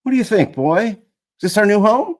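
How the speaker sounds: high, loud eager question to the dog; excited. Acted. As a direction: ask it bright and hopeful. Boy asks the dog excitedly.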